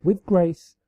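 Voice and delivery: usual speaking voice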